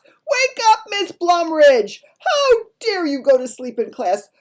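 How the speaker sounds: loud, high, outraged bellow; head teacher's shout. A narrator's reading. Head teacher rousing a sleeping teacher to sack her, furious shout. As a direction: shout it as a scolding head teacher, drawing out key words. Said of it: "he shouts".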